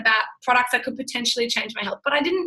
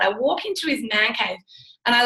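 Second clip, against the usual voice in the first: ordinary talk, nothing marked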